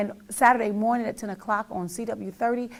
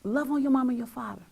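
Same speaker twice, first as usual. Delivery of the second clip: ordinary talk, nothing marked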